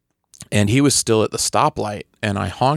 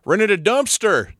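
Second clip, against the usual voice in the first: high, drawn-out crowing; mock proud announcement; 9 semitones higher, slower than usual. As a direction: crow it slowly to the whole street.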